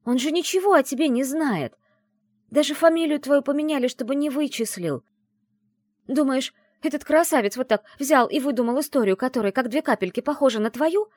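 high, loud, insistent reasoning; heated arguing. A narrator's reading. Friend convincing the doubting heroine, heated arguing.

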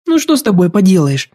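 high-voiced, sighing resignation. A narrator's reading. Юлька gives in to stubborn sick Денис, sighing. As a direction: give in with a fond sigh. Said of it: "вздохнула она".

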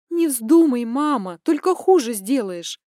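loud urgent plea; sharp warning. Acted. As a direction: stop her, loud and urgent. Daughter forbidding sharply.

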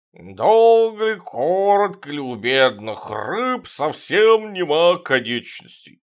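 put-on slow slurred drunken drawl; town drunk. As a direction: drone like a drunk at the table. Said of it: "Васильев басом пьяно". (пьяный бас)

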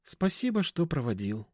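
feeble thanks, starting high and dropping; faint, drained thanks; weak voice. A narrator's reading. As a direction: thank weakly, letting the voice sink. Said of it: "слабым голосом ответила она".

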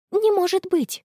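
high-pitched shocked exclamation; disbelief. A narrator's reading. Friend talks to her reflection about a photo, disbelieving.

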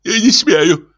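high, timid protest; meek priest's high-pitched refusal; flustered babble. Acted. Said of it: "забормотал отец Фёдор".

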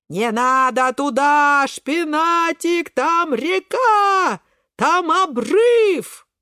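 high, long-stretched yells; panicked warning. Acted. Friends warning of the cliff, high drawn-out panic. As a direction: cry a desperate warning, dragging each word.

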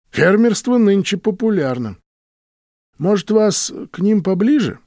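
lightly voiced truck driver.

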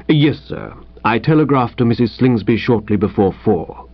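measured, level, formal servant's report; deferential manservant. Acted. Manservant reporting travel plans to his master, deferential. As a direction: recite the itinerary with unruffled precision.

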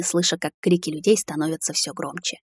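plain reading voice

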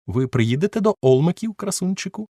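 high, flirty coaxing voice; coy duchess. Acted. Duchess inviting the narrator, high and flirtatious. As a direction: flutter it up like a society lady. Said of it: "сказала вона".

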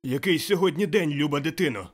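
loud, high, eager question; calling out. A narrator's reading. Scrooge repeating his question to the boy, calling out. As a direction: call it down excitedly. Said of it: "гукнув Скрудж".

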